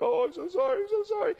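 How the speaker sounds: put-on high whining kid, way up high; a child caught out, pleading in a high squeaky voice; whiny kid apology. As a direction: mimic a kid whining sorry. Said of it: "They're just like".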